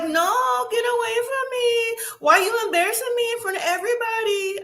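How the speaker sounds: high, tearful imitation, hammed up; mock crying. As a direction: wail it like a sobbing girlfriend, mock-style. Girlfriend crying in the car.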